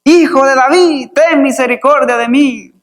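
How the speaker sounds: high-pitched, urgent acted call; insistent crying out. Preacher voicing the blind man refusing to be silenced, crying out. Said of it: "él clamaba, dice, mucho más".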